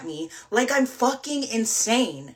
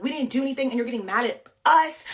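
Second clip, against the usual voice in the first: higher-pitched retelling of an angry protest; mock yelling; 5 semitones higher, 4 dB louder, faster than usual. Hinge guy protesting being blamed, mock yell. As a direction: mildly imitate his indignant yelling. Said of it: "He's screaming back at me".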